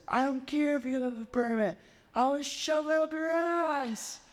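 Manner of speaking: high, mock-furious threat, slowing at the end; angry threat. Heated man threatening the party host, imitated angry yell. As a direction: snarl it like a heated stranger. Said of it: "He goes".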